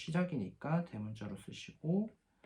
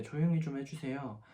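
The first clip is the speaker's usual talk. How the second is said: normal talking voice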